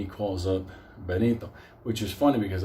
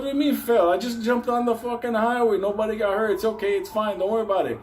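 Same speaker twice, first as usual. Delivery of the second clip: high, insistent protest; imitated frantic arguing; 10 semitones higher than usual. Driver defends jumping on the highway, frantic. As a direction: argue back in a worked-up high voice.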